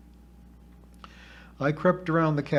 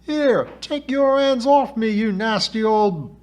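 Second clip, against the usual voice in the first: high, loud whining outburst, slowing at the end; shrill-pitched complaining whine; terrible whine; 9 semitones higher, 6 dB louder than usual. A narrator's reading. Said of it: "A terrible voice whined".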